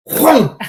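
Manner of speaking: high whooshing swoosh; whoom sound effect. Retold or imitated. Bouncer imitating a deputy's tackle, whooshing sound. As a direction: make the noise of someone vanishing fast.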